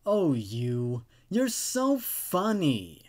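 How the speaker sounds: an unseen presence mocking the narrator; mysterious voice. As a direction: drag out "so" like a creepy tease. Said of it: "said a mysterious voice".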